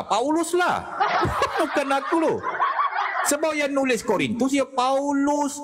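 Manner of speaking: high, laughing insistence; laughing protest. Real, not acted. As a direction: defend yourself through laughter. Laughing self-defense, blames Paulus.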